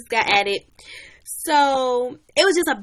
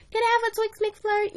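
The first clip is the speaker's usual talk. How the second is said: put-on very high, pestering kid sister voice; nagging little sister; 12 semitones higher than usual. Little sister now begs for a McFlurry, nagging. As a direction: switch treats and keep begging. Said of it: "she just kept nagging me".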